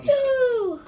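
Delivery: high loud blurted answer; excited shout. Real, not acted. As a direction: shout the number out eagerly.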